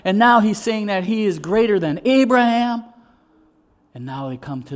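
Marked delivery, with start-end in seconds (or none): 0.0-2.8 high, loud, scandalized protest; going overboard. Acted. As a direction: protest loudly, building in disbelief. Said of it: "they're going overboard because they're saying".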